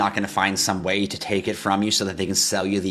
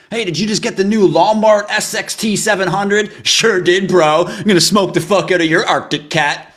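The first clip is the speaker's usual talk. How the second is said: much higher, louder hyped-up bro voices; snowmobile bros; 12 semitones higher, 11 dB louder than usual. Retold or imitated. Host imitating snowmobile bros bragging about a new sled, loud. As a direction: play two brash bros bragging about sleds. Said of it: "Sounds like a sled or a snowmobile".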